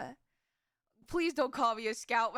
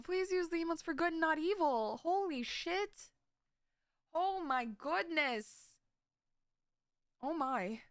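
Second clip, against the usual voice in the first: ordinary delivery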